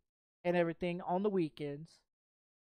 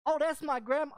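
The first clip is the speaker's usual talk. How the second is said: imitating girlfriend; 7 semitones higher, 4 dB louder than usual. Girlfriend voiced protesting.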